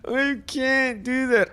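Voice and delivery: put-on high, tearful little-girl whine; imitating a crying child. Father imitating his crying daughter, high whine. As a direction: mimic the daughter whimpering through tears. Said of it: "she's crying and she's like".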